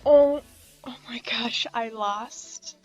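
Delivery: ordinary talk, nothing marked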